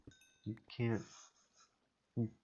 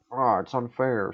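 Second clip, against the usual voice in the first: loud mock whine of complaint; mock whining; 2 semitones higher, 8 dB louder than usual. Players griping about nerfs, mock whining. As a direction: imitate players whining, pushed louder. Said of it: "people just fucking whine about it".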